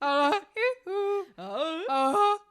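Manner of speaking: high loud honking uh-huh; goose honk. Shown as a demonstration. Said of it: "I can't do the goose".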